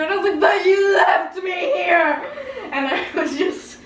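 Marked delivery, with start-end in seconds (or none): 0.4-2.2 slow, loud, drawn-out accusation; overdramatic protest. Retold or imitated. Daughter to her dad, overdramatic loud protest. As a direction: whine it at him like a betrayed child. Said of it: "really overdramatic".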